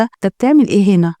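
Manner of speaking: ordinary delivery, nothing marked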